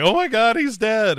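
high, loud hammed-up shock; mock alarm. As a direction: gasp it out in fake horror. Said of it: "And you're like".

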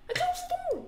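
very high, panicked yelling; shrieked protest. Real, not acted. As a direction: scream it in disbelief, over and over. Protests after being jump-scared.

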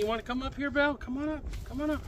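high soft coaxing voice for the dog; talking to a pet. Real, not acted. Calling the dog up.

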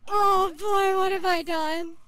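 loud, high, laughing wail; laughing despair. Real, not acted. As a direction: cry out laughing at the mess.

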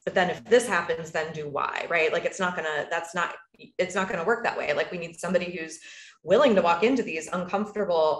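ordinary talk, nothing marked

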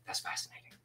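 breathy near-whisper Shatner impression; a hushed, mock-grave Shatner; imitating William Shatner. Shatner reacting to Tyson's confession, hushed mock impression. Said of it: "William Shatner's like".

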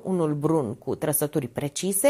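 ordinary delivery, nothing marked

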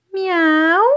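high, loud, sing-song kitty meow; cat meow. Pretend. Reading an animal book to kids, a loud high cat meow. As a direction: meow like a cat to delight the children.

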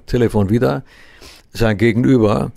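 ordinary talk

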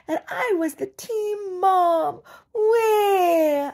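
high, slow, loud fake wail; hammed-up tearful team mom; mock crying mother. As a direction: stretch it out like a self-pitying sob.

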